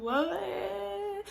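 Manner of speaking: high, drawn-out baffled question; mock shock. Patient overhearing the screams, acted bewildered what. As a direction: stretch it out high in disbelief.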